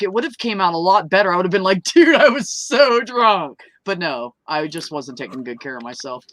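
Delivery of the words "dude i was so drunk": slow, slurred mock drunk; lazy slurred party drunk. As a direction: drawl it out like you're wasted. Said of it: "I wish I was drunk".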